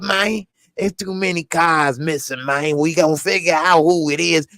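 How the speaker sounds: lazy, sing-song player talk with lots of man; Terrence Howard impression. Impersonating Terrence Howard as Dante inviting someone into a Maserati. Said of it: "I meant to do this impression".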